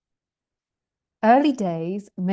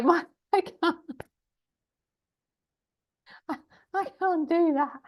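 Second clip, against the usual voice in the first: high, squeaky words through laughter; helpless laughter; 9 semitones higher than usual. Real, not acted. Teacher defeated by a complex question, helpless laughter. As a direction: crack up and give up mid-sentence. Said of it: "I'm so sorry for laughing".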